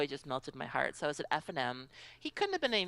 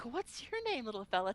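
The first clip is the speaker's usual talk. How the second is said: put-on high, sweet baby talk; cooing at a child; 7 semitones higher than usual. Player greeting cute kid, high sweet coo. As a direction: coo gently to a small kid. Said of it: "I went from, like".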